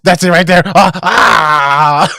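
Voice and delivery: loud, high, hammed-up cries of relief; relieved old lady. Old lady finally getting scratched, hammed-up relief. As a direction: moan and cry out in mock ecstasy. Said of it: "And she's like".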